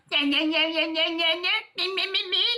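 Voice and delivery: hammed-up mocking babble that climbs in pitch; mocking gibberish. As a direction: mimic him with nagging nonsense syllables. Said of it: "why are you talking to me like that?".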